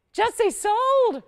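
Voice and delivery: loud, high urging cry; excited sold call. Real, not acted. Host urging callers to phone in, loud and excited. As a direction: whip buyers into a frenzy.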